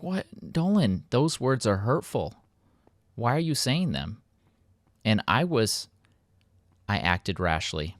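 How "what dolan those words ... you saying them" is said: mock hurt. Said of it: "I'm like".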